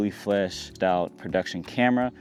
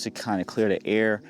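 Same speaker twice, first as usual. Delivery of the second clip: ordinary delivery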